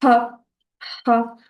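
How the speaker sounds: isolated "ha" with a strong pharyngeal H; throaty Arabic ha. Shown as a demonstration. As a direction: push the H of "ha" out from the back of the throat. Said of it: "une lettre difficile à prononcer".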